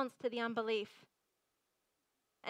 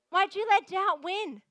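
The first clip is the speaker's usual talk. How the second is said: emphatic, louder; 5 semitones higher, 7 dB louder than usual. Shown as a demonstration. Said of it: "second take, louder and higher".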